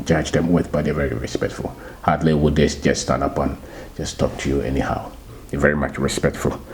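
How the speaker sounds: ordinary talk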